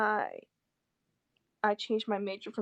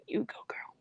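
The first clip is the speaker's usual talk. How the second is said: voiceless, dry mock cheer; sarcastic whisper; 10 dB quieter than usual. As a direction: whisper a fake encouragement. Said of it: "I'll end up being like".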